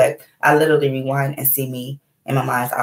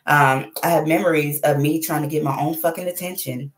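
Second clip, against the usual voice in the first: ordinary talk, nothing marked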